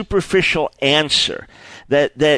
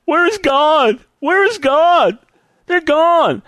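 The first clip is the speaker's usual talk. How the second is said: high-pitched, distraught wail building as it goes; acted crying; 9 semitones higher than usual. Grieving caller telling a friend of a fatal crash, crying. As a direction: cry it out in anguished disbelief, acted. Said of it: "they're crying and say".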